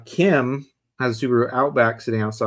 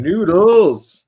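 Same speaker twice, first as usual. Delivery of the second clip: excited; 7 semitones higher, 9 dB louder than usual. Real, not acted. Excited call of noodles.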